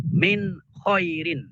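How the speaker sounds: "min khairin" very slow with the n said clearly; izhar, clear nun. Shown as a demonstration. Teacher demonstrating izhar slowly, clear n. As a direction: pronounce the n in "min" distinctly before kh.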